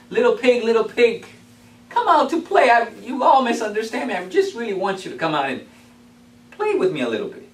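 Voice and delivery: loud, high coaxing wolf; wolf sweet-talking the pig; big bad wolf voice. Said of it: "and he says".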